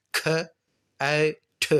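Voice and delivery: کھ اے ٹھ sounded out with breathy aspirated k and t; English aspirated cat. Shown as a demonstration. Teacher shows students how the English say cat, aspirated. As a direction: puff air on the k and t of کھیٹ. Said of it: "وہ سی کو کھ پڑھتے ہیں اور ٹی کو ٹھ".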